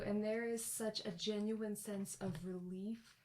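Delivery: normal talking voice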